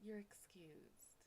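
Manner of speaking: slow, breathless whisper; swooning whisper. Acted. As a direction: whisper it as if completely charmed. Swooning reply to British charmer.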